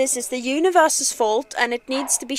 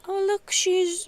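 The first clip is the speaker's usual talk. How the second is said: normal talking voice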